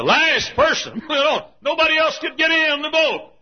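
high, shouted altar call; full-force preaching. Real, not acted. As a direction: cry out the invitation to the crowd.

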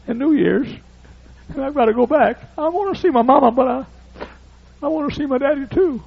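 put-on boy on plane tells preacher why he cries, high child voice; crying boy's voice. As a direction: speak as a tearful little boy.

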